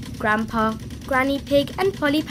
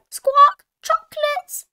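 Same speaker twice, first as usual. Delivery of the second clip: very high parrot squawk; Polly Parrot; 8 dB louder, slower than usual. A narrator's reading. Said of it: "Polly Parrot copied".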